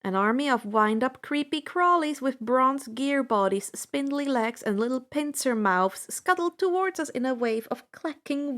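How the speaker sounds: plain reading voice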